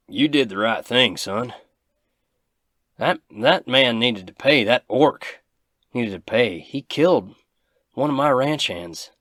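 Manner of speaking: put-on proud, heated old rancher; gruff rancher. Rancher to Bradley, loud and defiant. GM voices angry rancher Big Al.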